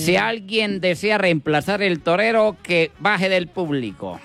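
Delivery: announcer asking the crowd for a new bullfighter; bullring announcer. Acted. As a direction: announce it to the stands. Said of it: "el animador dio".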